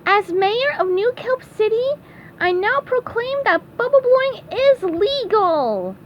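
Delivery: high-pitched victory speech; SpongeBob. Acted. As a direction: proclaim it high, like a cartoon mayor. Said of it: "SpongeBob delivered his victory speech".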